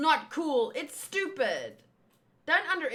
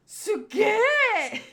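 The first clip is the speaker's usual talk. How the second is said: higher, louder amazed cry in a voice unlike his own; Luffy's "suge"; 8 semitones higher, 6 dB louder than usual. Retold or imitated. Fan imitating Luffy's amazed face, higher loud cry. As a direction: gasp it out wide-eyed like Luffy. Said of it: "When Luffy makes that face, that is how he says it".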